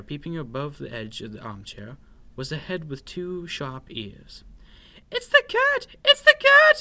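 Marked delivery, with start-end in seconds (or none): 5.1-6.8 very high, loud mouse shriek; panicked cry. A narrator's reading. Scared mouse spots a stripy tail, shrieking in panic. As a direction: squeal the alarm at the top of your voice. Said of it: "he cried".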